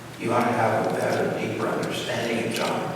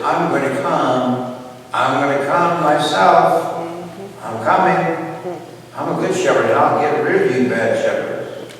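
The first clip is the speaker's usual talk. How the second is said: God's loud warning; 4 semitones higher, 7 dB louder than usual. Acted. Voicing God's warning to shepherds.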